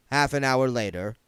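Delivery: ordinary delivery, nothing marked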